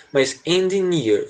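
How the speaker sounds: engineer drawn out slowly, endiniɾ; careful engineer. Shown as a demonstration. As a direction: stretch engineer slowly and clearly. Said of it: "junta na pronúncia esse an". (engineer bem devagar e claro)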